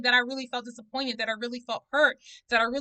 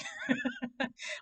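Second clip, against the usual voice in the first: high laugh; 9 dB quieter than usual. Real, not acted. Laughing at own drinking joke.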